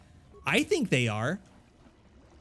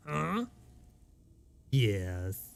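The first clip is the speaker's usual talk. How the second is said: ordinary delivery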